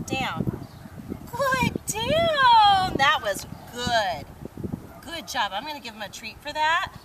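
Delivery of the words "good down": high, loud drawn-out praise; excited praise. Real, not acted. Trainer rewarding the dog's down, high and loud. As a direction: sing it out up high.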